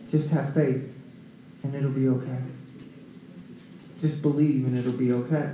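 low, soft and steady; quiet reassurance. Acted. Jesus comforting a father whose daughter died, quietly reassuring. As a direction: reassure gently, voice dropped low.

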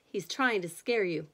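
ordinary delivery

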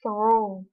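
"Through" with the gh left silent; plain model "Through"; clear through. Shown as a demonstration. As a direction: say "Through" as thru. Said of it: "từ cuối cùng là through".

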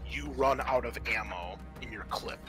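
normal talking voice

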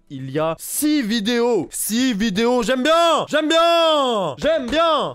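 building to a high, loud excited shout; thrilled yell at good news. Real, not acted. Hyped reaction to six videos.

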